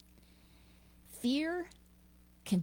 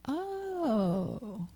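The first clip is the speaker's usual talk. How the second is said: usual speaking voice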